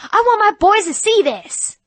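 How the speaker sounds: high, loud indignant Southern mother; angry mother. Retold or imitated. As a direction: scold the rude teens.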